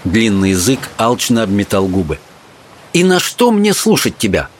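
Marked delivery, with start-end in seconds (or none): 2.9-4.5 put-on higher, defiant young girl; girl standing tall, defiant; girl's voice. A narrator's reading. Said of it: "сказала ему девушка".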